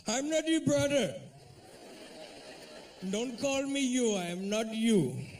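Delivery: high-pitched, indignant mock Indian accent; offended Indian man. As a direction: slowly reject him in an Indian accent. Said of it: "but they get offended".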